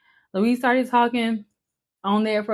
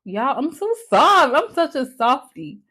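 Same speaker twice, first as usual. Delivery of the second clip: normal talking voice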